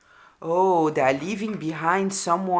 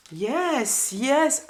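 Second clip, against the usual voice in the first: excited; 7 semitones higher, 5 dB louder, much slower than usual. Real, not acted. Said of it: "this makes me emotional".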